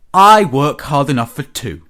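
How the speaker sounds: loud, aggrieved complaint; indignant puffing. A narrator's reading. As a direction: huff it out loudly, feeling hard done by. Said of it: "he puffed".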